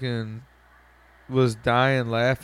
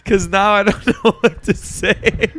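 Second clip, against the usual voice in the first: laughing; 6 semitones higher, 4 dB louder than usual. Retold or imitated. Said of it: "I was just like".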